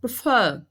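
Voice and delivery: Prefer with a reduced first syllable and strong second; stress on last syllable. Shown as a demonstration. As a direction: punch the final syllable of Prefer. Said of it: "A sílaba mais forte é o fã". (Prefer com força na última sílaba)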